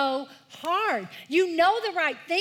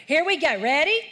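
loud cue; 2 semitones higher, 6 dB louder than usual. Real, not acted. Children's pastor rallying the kids, loud and rousing. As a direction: pump the room up before the verse. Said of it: "I should hear ya".

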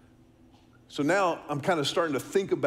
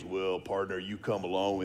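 security guard; 11 semitones lower, 6 dB quieter than usual. Retold or imitated. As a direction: drop low and easy like a friendly old guard. Security guard speaking to the boy.

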